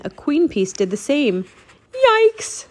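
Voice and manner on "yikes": very high, loud yelp; frightened exclamation. A narrator's reading. Annie sees chess pieces move alone, frightened yelp. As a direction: yelp it in alarm.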